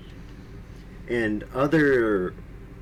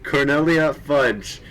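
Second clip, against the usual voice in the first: usual speaking voice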